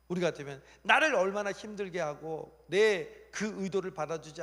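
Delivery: hammed-up fed-up grievance; venting temper. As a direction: protest bitterly, building to a long wail. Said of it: "그러니까 자기 승질을 얘기합니다".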